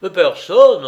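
loud, high, quick protest; alarmed servant's hurried answer; hasty denial. A narrator's reading. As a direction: blurt it to cover up. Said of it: "répondit précipitamment Onissime".

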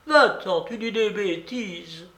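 high, exasperated dismissal; impatient babouchka. A narrator's reading. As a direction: send him packing in a high old-lady voice. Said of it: "La babouchka perdit patience". (babouchka à bout de patience)

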